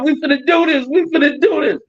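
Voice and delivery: high-pitched hyped-up chant, no extra volume; retold rallying cry. Streamer retelling his crew's standoff, excited mock rallying cry.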